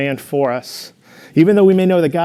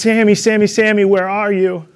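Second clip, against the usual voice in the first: calling out; 6 semitones higher, 3 dB louder than usual. Retold or imitated. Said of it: "running through the bushes and said".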